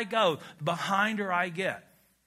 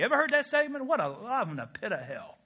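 ordinary talk, nothing marked

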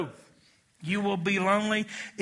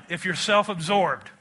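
ordinary talk

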